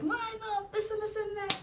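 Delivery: high mock instructor command; instructor barking. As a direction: bark the order like a drill instructor. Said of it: "They're going crazy".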